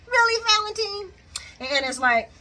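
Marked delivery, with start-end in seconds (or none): 0.1-1.1 high put-on voice; exaggerated high-pitched disbelief; mock incredulous. Reacting to Valentine keeping secrets, high mock incredulity. Anna scolds Valentine, mocking.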